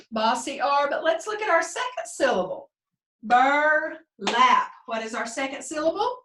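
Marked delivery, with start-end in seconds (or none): 3.2-4.7 "Burlap" in two firm, separated beats; "Burlap" broken after bur, both parts emphatic; split into syllables. Shown as a demonstration. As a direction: punch out bur, then lap in "Burlap". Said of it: "Let's clap it again".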